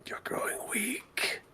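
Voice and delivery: slow, soft haunting whisper; whispered taunt. Acted. Swirling voices prey on Strahd's age, slowly whispered. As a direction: draw it out in an eerie hush.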